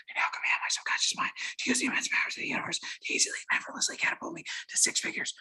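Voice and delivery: fast whispered incantation; hushed, breath-only recitation. Shown as a demonstration. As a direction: whisper it rapidly, like a secret mantra. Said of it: "I would whisper".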